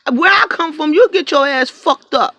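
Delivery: outraged rant about being lowballed; indignant high shout. Real, not acted.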